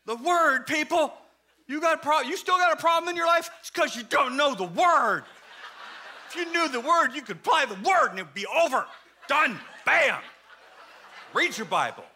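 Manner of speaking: high, punchy scolding of the class; insistent Bible teacher. Acted. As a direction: hammer the point like a zealous teacher. Preacher acts insistent Bible teachers.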